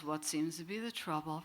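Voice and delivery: deadpan. Retold or imitated. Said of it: "Very deadpan".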